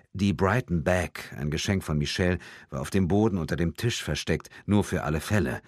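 usual speaking voice

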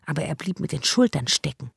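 ordinary delivery

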